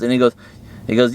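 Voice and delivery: ordinary delivery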